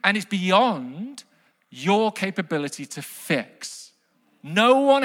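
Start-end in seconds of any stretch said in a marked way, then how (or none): none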